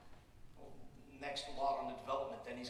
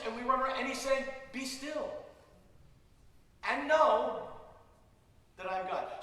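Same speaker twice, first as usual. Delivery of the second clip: forceful preaching; 8 semitones higher, 10 dB louder than usual. Acted. Preacher urging the congregation, preaching loudly.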